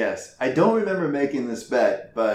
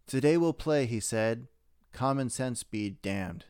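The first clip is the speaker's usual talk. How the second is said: poem recital; 7 dB quieter than usual. Acted. Podcast host reading a mock holiday poem aloud. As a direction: recite it like a Christmas-eve verse. Reads comic Christmas-style poem.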